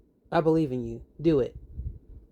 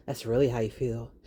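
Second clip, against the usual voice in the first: ordinary talk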